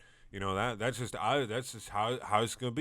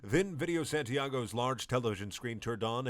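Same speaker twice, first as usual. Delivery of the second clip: ordinary delivery, nothing marked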